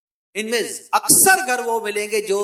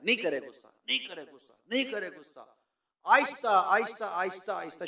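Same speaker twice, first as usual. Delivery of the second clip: much softer, lower soothing repetition; calming hush; 4 semitones lower, 8 dB quieter than usual. Shown as a demonstration. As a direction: talk yourself down gently. Soft demo of swallowing anger.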